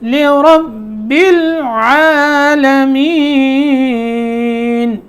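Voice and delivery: لرب العالمین slowly, no bounce on the ba; no qalqala. Shown as a demonstration. Teacher demonstrating smooth joining, slow. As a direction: recite لرب smoothly, ain from the throat. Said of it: "یہاں خیال رکھنا ہے".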